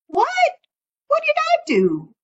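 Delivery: very high startled squeak; a high yelp of surprise; high squeaky what; startled raccoon. Acted. Raccoon startled in folk tale.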